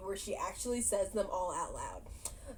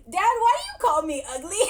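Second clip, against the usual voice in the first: higher, louder, fast blurt; blunt outburst; 9 semitones higher, 9 dB louder, much faster than usual. Retold or imitated. Daughter blurting at her dad, loud and fast. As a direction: blurt it straight out. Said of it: "she just straight out goes".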